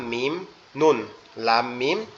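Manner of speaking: normal talking voice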